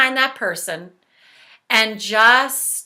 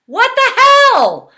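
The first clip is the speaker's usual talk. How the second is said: mock outraged yell; 13 semitones higher, 9 dB louder than usual. Shown as a demonstration. Demonstrates venting while tapping.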